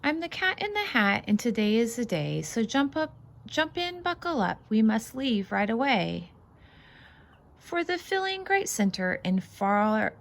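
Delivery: lively, bouncy read-aloud voice; singsong picture book reading; reading to children. Acted. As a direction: read it out like a storybook for little ones. Reads picture book aloud to kids.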